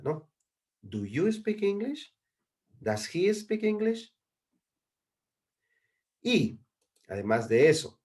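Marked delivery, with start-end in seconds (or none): 0.8-2.2 "Do you speak English?" rising high and staying up; soft-edged, slow, high question on "Do you speak English?"; question intonation. Shown as a demonstration. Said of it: "cambiar el tono al momento de hacer la pregunta". 2.8-4.2 "Does he speak English?" climbing high and held up; slow, high asking tune on "Does he speak English?"; question intonation. Shown as a demonstration. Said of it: "cambiar el tono al momento de hacer la pregunta".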